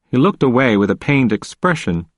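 ordinary delivery

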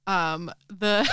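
normal talking voice

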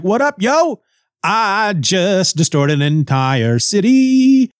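high, showy boast, drawn out; cocky comic greeting; mock bragging. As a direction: swagger in and brag, hammed-up. Acted swaggering show-off arrives.

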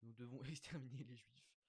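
lightly voiced crowd reply, breaking into a laugh; a hint of the chanting mob, with a laugh; imitating the crowd. Mock crowd answering Cartman, with a laugh. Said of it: "la foule répond".

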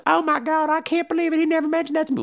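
high put-on young woman's voice; high girlish voice. Danielle Harris's character asks Tony Todd, high girlish. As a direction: ask it wide-eyed in a high voice. Girl reacts to the reveal.

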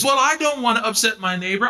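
lightly mocked timid voice; timid excuse. Preacher mocking timid Christians, lightly mocking timid voice. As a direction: mimic a fearful believer. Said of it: "running from fear of what".

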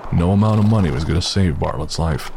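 ordinary talk, nothing marked

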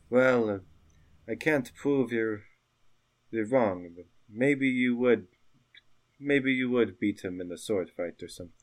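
halting, reluctant concession in character; grudging townsperson. Acted. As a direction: concede doubtfully, with hesitant pauses. The couple says.

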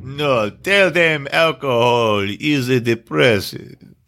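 low, slow gangster voice; slow menacing crime boss; drug lord voice. Retold or imitated. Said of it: "He's like".

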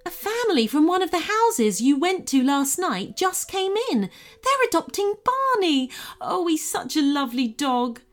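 high, eager, bubbling over; gushing excitement. Acted. Bev tells the kids Barney is adopted, gushing. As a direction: share the good news, thrilled.

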